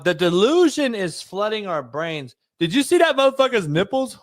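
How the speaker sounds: usual speaking voice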